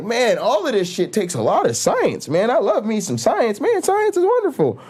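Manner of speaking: high, gushing fan voice climbing into a squeal; gushing science fan. Retold or imitated. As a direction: swoon over science like a giddy fanboy. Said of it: "And you're like".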